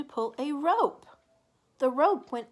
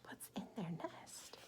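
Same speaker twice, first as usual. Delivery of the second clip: whispered question; 7 semitones lower, 18 dB quieter than usual. Real, not acted. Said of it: "asks children in a whisper".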